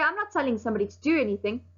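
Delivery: ordinary talk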